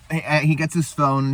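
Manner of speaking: ordinary talk, nothing marked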